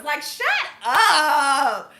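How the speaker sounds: slow, drawn out through a laugh; laughing shut up. Real, not acted. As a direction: say it while laughing at them. Pet peeve about gender wars.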